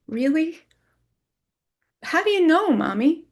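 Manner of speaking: put-on high, hopeful little-girl voice; childlike pleading question; small child. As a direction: ask like a toddler looking up. Daughter answers in childlike voice.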